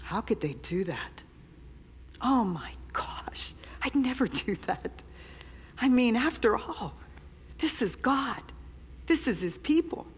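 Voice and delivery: hushed, smug disbelief at their sin; softly scoffing new believer; mock self-righteous. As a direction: play your younger self, sure you'd never. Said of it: "I read this and went".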